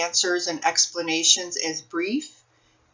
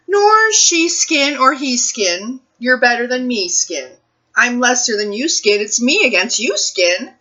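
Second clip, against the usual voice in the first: lively, sing-song picture book read-aloud, slower and higher; reading to children; 5 semitones higher, 7 dB louder, slower than usual. Acted. Librarian reading a picture book to children, expressive storytime voice. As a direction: read it like storytime for little kids, savoring each rhyme.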